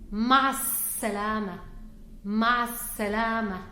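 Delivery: ma ssalama with a long, leaning s; stressed s. Shown as a demonstration. Teacher stressing the s for students. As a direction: lean on the s in salama, slowly. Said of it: "we also stress on the sound of s".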